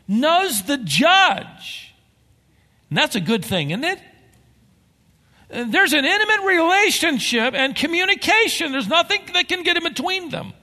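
preacher building to a peak; climactic preaching. Acted. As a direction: drive it home to the congregation.

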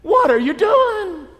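high, loud, drawn-out outcry; indignant protest. Acted. Workers challenging the landowner's pay, indignant. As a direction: stretch it into an outraged question.

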